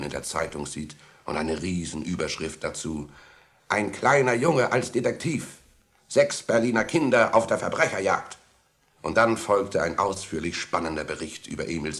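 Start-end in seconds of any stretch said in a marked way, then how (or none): 3.7-8.4 higher, louder headline voice; headline announcement. A narrator's reading. Narrator reads out the newspaper headline, announcing loudly.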